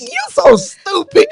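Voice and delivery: high, slow laughing mock scold; playful scolding. Friend imitating a mentor scolding her, laughing mock scold. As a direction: tease her like a fond big sister. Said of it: "she was like".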